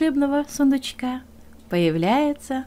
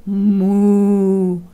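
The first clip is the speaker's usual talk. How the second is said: bull's moo; 2 semitones lower, 6 dB louder than usual. Acted. Bull greets the hare with a loud moo.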